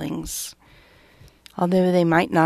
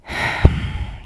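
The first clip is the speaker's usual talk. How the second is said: long breathy exhale; audible sigh. Real, not acted. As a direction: let out a sigh before moving on. Breath before moving on.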